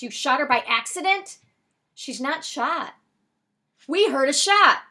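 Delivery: loud, high exchange. A narrator's reading. Man on the phone asks about an accident, loud and alarmed. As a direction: press the point loudly. Heated phone argument, raised voices.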